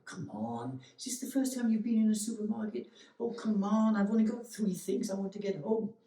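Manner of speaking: impatient inner grumble. Retold or imitated. Your impatient mind fumes at the old lady at the till. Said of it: "the mind's going".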